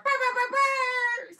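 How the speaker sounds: loud booming brr sounds; bass thump noise. Pretend. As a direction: shout out a car bass rhythm. Imitating a car stereo bass again.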